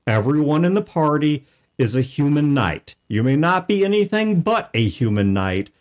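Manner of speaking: ordinary talk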